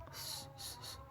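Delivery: "S-" hissed with the breath held in puffed cheeks; heavy sad with full cheeks. Shown as a demonstration. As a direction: puff the cheeks and hiss "S-". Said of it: "Tertahan nafasnya di pipi".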